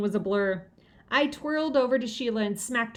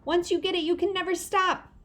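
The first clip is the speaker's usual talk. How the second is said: put-on little girl voice; 9 semitones higher than usual. A narrator's reading. Mitzi voiced as child.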